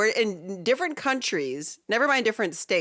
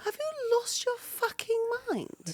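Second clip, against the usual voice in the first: high, slow, outraged British accent; 6 dB quieter, slower than usual. Shown as a demonstration. Co-host answering a jibe about Jamaica, outraged British accent. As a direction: act affronted in put-on British. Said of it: "speak with a British accent".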